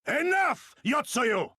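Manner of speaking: high, loud cry to halt her; furious shout of her name; commanding shout. Acted. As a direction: call her out across the square. Confronts the viceroy, shouting her name.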